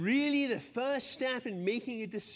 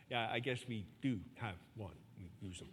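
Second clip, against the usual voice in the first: much softer, lower, sheepish mumble; sheepish admission; 11 semitones lower, 9 dB quieter than usual. Retold or imitated. The crowd admitting they carry the coin, sheepish and soft. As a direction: caught out, mumble it.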